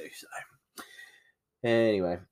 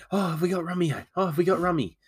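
high, eager voice of the wife; 10 semitones higher than usual. Retold or imitated. Wife's eager board game request.

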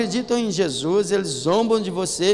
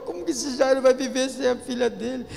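high, sing-song lament; pitying mourner; 5 semitones higher than usual. Pretend. Preacher mimicking mourners pitying Jairus, pitched high. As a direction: play the gossiping mourner.

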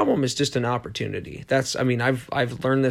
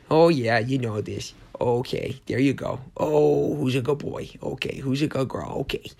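put-on pet-owner voice; doting baby talk for dogs; 2 semitones higher than usual. Shown as a demonstration. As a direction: coo it to a dog being petted. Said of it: "talking like this".

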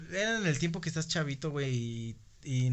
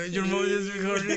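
higher, loud mock English; spanglish; 5 semitones higher, 3 dB louder than usual. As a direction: mimic the balloon's garbled English. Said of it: "es como".

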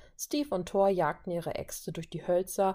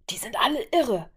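raspy aside, pitched up, meant to be overheard; stage murmur; 6 semitones higher than usual. A narrator's reading. Tony confides in Vajo about the others, stage murmur. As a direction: confide it in a half-whispered higher voice. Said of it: "raunte er ihr zu".

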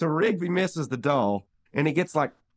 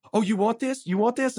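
high mocking taunt; taunting Rigby; 8 semitones higher than usual. Rigby taunting from the top rope, high mock voice. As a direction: tease him from the top rope, pitched up. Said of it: "he's like".

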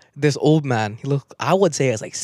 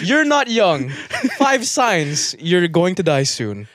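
slow mock announcement; 4 semitones higher, much slower than usual. Mock presentation title.

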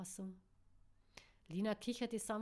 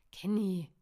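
loud agitated call of a name; a loud, flustered cry of Kenny; upset call; 11 dB louder than usual. A narrator's reading. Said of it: "rief Caro aufgebracht".

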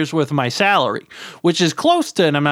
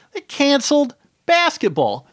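high-pitched, incredulous repeat; mock dismay; 9 semitones higher than usual. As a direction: repeat the news as if it's an outrage. Repeats bad news in disbelief.

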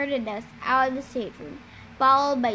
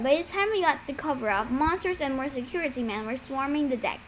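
usual speaking voice

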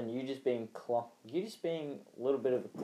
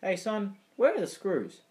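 dad asking; 8 semitones higher than usual. Retold or imitated. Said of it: "he says".

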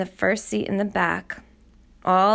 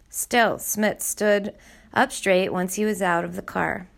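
plain reading voice

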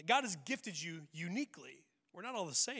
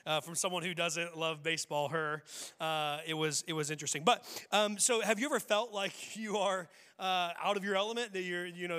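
ordinary talk

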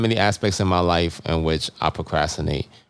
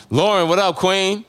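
hype shout-out; 10 semitones higher, slower than usual. Real, not acted. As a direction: call out to a friend with energy. Shouting out listeners on podcast.

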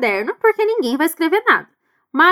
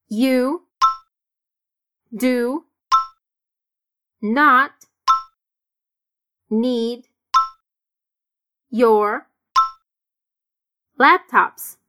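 slow, with a pause after each word; 2 semitones lower, much slower than usual. Shown as a demonstration. Teacher dictating the answer slowly. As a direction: give each word alone.

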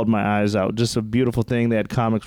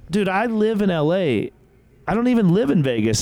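higher, exasperated retelling; exasperated explaining; 9 semitones higher than usual. Retold or imitated. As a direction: spell it out to a clueless cop.

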